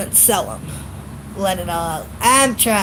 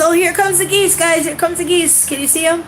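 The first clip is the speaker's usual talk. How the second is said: excited; 8 semitones higher than usual. Real, not acted. Filming geese overhead for her viewers, excitedly calling out. Spotting geese flying over.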